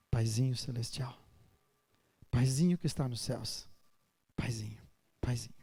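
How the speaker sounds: soft, fading, intimate calling; gently fading words to a beloved father; tender prayer. Pretend. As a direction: murmur it like a child falling asleep. Speaking intimately to God.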